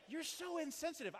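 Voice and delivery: accusing listener. Pretend. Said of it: "Some of you, like".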